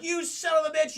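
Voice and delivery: hammed-up betrayed shout; mock outrage. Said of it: "they're like".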